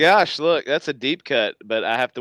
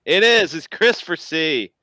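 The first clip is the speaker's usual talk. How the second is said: excited; 6 semitones higher, 6 dB louder than usual. Real, not acted. Excited realization, louder.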